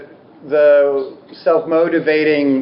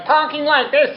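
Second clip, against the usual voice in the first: rushed, higher, hammed-up neurotic chatter; neurotic New Yorker; 12 semitones higher than usual. Teacher mocking her own neurotic inner voice, fast and high.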